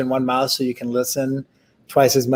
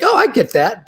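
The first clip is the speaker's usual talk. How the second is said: higher-pitched imitated flash of recognition; sudden understanding; 9 semitones higher, 4 dB louder than usual. Imitating a listener as an idea resonates, sudden understanding. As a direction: voice someone suddenly getting it. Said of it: "resonance is usually an emotional".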